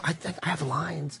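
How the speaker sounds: very soft, slow, tentative; timid mumble. Retold or imitated. Newcomer timidly telling staff about his lines, very quiet. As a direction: speak up meekly, barely audible. Meekly speaking up at check-in.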